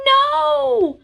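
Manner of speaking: high, loud panicked no; frightened cry. Acted. Piglet stopping Pooh opening the door, high panicked cry. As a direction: cry out in alarm. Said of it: "cried Piglet".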